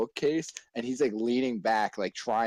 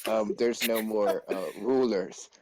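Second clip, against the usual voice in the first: slow, halting imitation of a kid; stuttering deep-voiced kid; slower than usual. Pupil telling teacher of a shortage, imitated slow stammer. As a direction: drag it out between hesitations. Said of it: "kinda stuttering ... like, a real deep voice".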